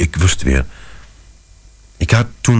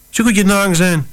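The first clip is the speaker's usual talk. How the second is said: put-on high, breezy young-father voice, much higher; upbeat dad brushing off worry; cheerful call; 12 semitones higher than usual. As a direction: sing it out cheerfully to reassure the wife. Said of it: "riep ik, opgewekt".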